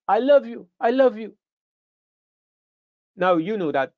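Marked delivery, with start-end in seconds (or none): 0.1-1.4 high, pleading repeated cry; frightened wife's hurried plea. Retold or imitated. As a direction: beg for mercy, pitched up. Beaten wife pleading.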